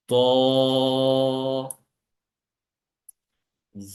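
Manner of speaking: "সদ, সদ, দদ, দদ, ত, জ।" each letter drawn out in a chanting tone; সদ সদ দদ দদ ত জ as a slow chanted letter drill; letter pronunciation drill. Shown as a demonstration. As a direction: chant each Arabic letter slowly and clearly, one by one. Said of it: "চারটা হরফকে একবার উচ্চারণ করছি".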